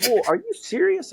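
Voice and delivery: retold disbelief. Staff asking the algae customer if he's serious, mock disbelief.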